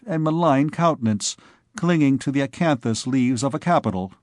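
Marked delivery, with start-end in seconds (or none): none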